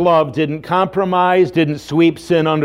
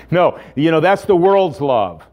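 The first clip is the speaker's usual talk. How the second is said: ordinary talk, nothing marked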